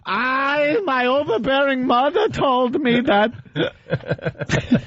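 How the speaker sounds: put-on high, slow, laughing Yiddish accent; hammed-up Yiddish lawyer voice, giggling through it. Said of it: "I could see him typing this up".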